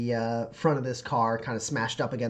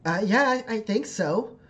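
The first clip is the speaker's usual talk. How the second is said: put-on higher, uncertain child voice; pitched-up, tentative reply; rescued child; 8 semitones higher than usual. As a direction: answer hesitantly as a little girl. Game master answers as rescued child.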